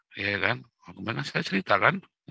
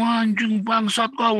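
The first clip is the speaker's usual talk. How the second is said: high, loud mock swearing; acted abusive outburst, raised voice; imitated cursing boss; 10 semitones higher, 5 dB louder, much slower than usual. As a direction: curse like a foul-mouthed boss. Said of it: "dimaki-maki sama dia loh".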